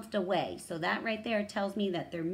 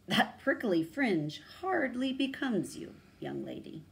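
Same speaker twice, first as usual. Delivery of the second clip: put-on old lion; 6 semitones higher, 4 dB louder than usual. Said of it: "leaned his old scarred face nearer and said".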